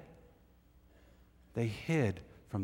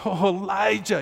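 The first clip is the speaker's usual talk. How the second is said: lightly voiced Obadiah, higher and louder; pleading servant; 8 semitones higher, 5 dB louder than usual. Obadiah falling before Elijah, mildly voiced awe. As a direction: cry out his name in awe. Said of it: "He goes".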